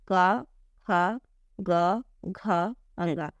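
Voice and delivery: slow, separated syllable drill. Shown as a demonstration. Teacher models the syllable drill for students, clearly separated. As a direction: say का ख ग घ अंगा one by one. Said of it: "इन शब्दों को बोलिए".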